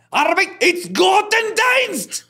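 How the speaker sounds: put-on German phrase in a booming German accent. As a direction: pronounce "Arbeit ist Gottesdienst" with a heavy German accent, slow and high. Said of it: "cuando habla como alemán".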